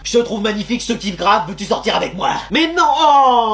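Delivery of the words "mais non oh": high, loud, long drawn-out protest; stretched cry of dismay; exasperated shout. Acted. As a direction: wail the objection slowly and loudly. Protest at note read by wrong person.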